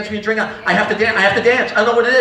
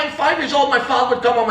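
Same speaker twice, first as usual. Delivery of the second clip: normal talking voice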